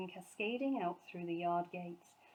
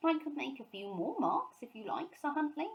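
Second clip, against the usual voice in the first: higher eager little bear; hopeful offer; 7 semitones higher than usual. A narrator's reading. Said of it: "he said hopefully".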